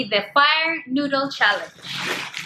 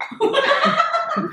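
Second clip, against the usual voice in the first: high giggle. Real, not acted. Laughing after video.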